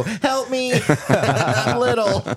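high, slow, squeaky little-person plea; tiny helpless voice. Retold or imitated. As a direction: play it small and pitiful, laughing. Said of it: "and I'll go".

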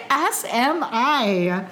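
high laugh then slow drawn-out reply. Real, not acted. Admitting she'll rewatch too, laughing and slow.

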